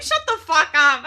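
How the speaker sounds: high, loud mock-outraged yell; exasperated shout. Streamer humbled by the kids' comparisons, exasperated mock shout. Retold outburst at mocking kids.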